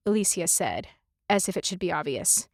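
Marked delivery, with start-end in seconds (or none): none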